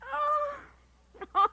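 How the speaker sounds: high, exasperated giving-in cry. Acted. Wife gives in to her husband's hat grief, exasperated. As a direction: let it burst out as you give up.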